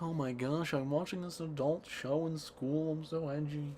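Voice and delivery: playing the edgy kid; mock edgy teen. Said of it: "So I'm like".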